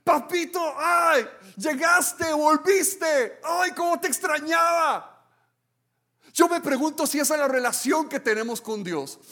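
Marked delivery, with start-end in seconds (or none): 0.0-5.1 much higher, loud, slow and stretched shout of delight; a child flinging herself at her returning dad; joyful child's shout. Pretend. Child greeting father home, shouting with joy. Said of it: "correr a sus brazos y decirle".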